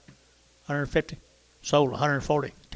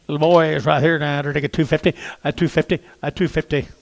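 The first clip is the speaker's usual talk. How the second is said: auctioneer calling; 8 dB louder than usual. Real, not acted.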